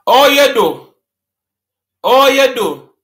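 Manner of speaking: loud, high patois "Oh, you do"; Jamaican patois riddle. Shown as a demonstration. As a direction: call out the patois phrase. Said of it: "The next one is".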